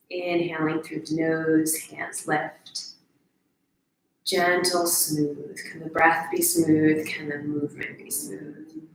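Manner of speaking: slow, soft breathing-guide voice; guided meditation. Real, not acted. Guide cueing a smooth breath and movement, hushed meditation voice.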